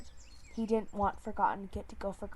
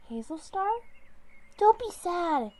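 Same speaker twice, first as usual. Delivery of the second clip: much higher, louder call; loud high plea; 9 semitones higher, 8 dB louder than usual. Acted. She-cat calling loudly to lift the leader's spirits. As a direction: call out to cheer him.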